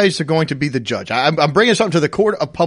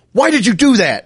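higher, indignant question; accusing mom; 7 semitones higher, 4 dB louder than usual. Retold or imitated. Mom accusing son at table, indignant higher voice. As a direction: demand it like she's been wronged. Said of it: "is like".

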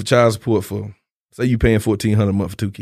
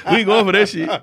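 said through laughter, a bit louder; laughing; 8 semitones higher, 4 dB louder than usual. Real, not acted. Joking with his friend about rent, laughing. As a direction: laugh the words out.

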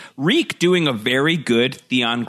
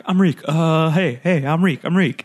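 imitating Theon; 5 semitones higher than usual. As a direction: ham up a nervous Reek. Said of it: "Theon being like".

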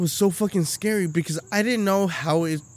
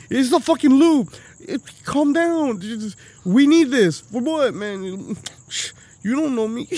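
exasperated protest; 6 semitones higher than usual. Retold or imitated. To Karen over the lube, exasperated and higher. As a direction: push back, annoyed and cornered.

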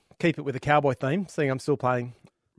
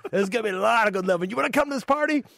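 cowboy voice; 12 semitones higher, 4 dB louder than usual. Acted. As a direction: play the friendly cowboy neighbour big. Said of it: "he goes".

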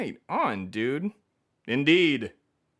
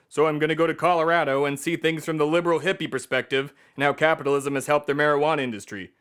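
ordinary talk

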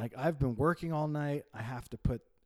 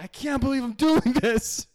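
loud, high comic disbelief; exasperated parent; 10 semitones higher, 9 dB louder than usual. Retold or imitated. Dad surrendering to his daughter, exasperated. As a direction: wail in mock disbelief at yourself. Retold surrender to child's demand.

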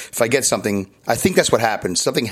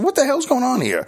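high-pitched retold disbelief; exaggerated high "what's happening" reaction; mock bafflement; 13 semitones higher than usual. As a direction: act out being baffled, voice shooting way up. Retelling his baffled reaction.

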